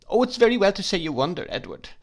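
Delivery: high, fast and upset; upset outcry. Acted. Wife crying out against her stern husband. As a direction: burst out in hurt protest. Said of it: "cried my mother".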